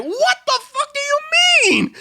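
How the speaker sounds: very high-pitched incredulous cry; outraged outburst. Retold or imitated. Guard hearing nuggets are gone, high outraged outburst. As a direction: shriek it in comic disbelief. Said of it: "I literally said that out loud".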